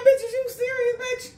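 shrill mock outrage at the attacker's nerve; sneering protector. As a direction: act the protector scoffing at the attacker. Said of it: "They be like".

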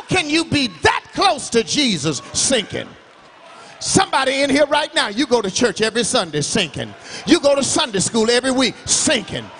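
preaching at full force. Real, not acted. Pastor driving his point home to the church. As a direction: hammer it home, line after line.

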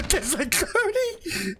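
high, slow laughing disbelief; panicked laughter. Real, not acted. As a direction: laugh it out in shock. Sees a horde of dogs.

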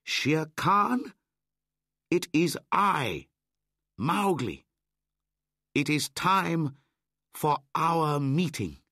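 high, loud call down the ravine; defiant loud challenge; shouting. A narrator's reading. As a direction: call out to the tiger below. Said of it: "shouted down the ravine".

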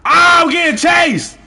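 panicked yell, high and loud; yelling while fleeing in panic; being chased. Real, not acted. Chased by killer in game.

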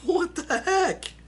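high, slow stunned what-the-heck; disbelieving. Real, not acted. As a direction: drag it out in disbelief. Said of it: "I'm just like".

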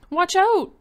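piercing high scream of warning. A narrator's reading. Lydia warns George as lions attack, screaming. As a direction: shriek it as the lions charge. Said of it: "screamed Lydia".

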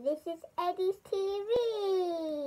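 singsong, slowly stretched announcement; sing-song show intro. Pretend. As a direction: announce the show, stretching every word out long. Child's drawn-out show intro.

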